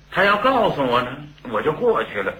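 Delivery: ordinary delivery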